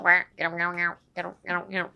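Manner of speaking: repeated throaty meows; froggy meow. Shown as a demonstration. Teacher demonstrating the throat position, froggy cat noise. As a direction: croak 'meow' like a frog or cat. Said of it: "カエルの".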